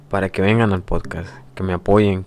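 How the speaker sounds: ordinary delivery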